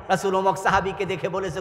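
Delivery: ordinary talk, nothing marked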